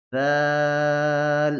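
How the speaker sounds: দাল held very long and slow; three alif stretch. Shown as a demonstration. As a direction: draw দাল out steadily. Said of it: "তিন আলিফ টান দিয়ে পড়তে হবে".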